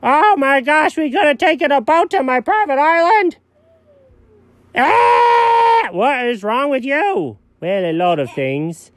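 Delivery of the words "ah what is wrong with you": high yell, then slower scolding; exasperated yell. Acted. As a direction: yell out in disbelief. Cat exasperated at dog.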